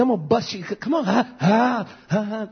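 high, gloating threat ending in ha ha; cocky taunt with mock laugh. Schoolboy threatening his opponent, high gloating taunt with laughs. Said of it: "He said".

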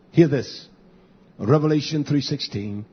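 ordinary delivery

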